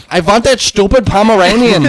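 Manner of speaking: goofy high director impression; mock voice. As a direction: play the eager director for laughs. Mocking the director's enthusiasm.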